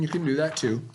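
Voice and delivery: ordinary delivery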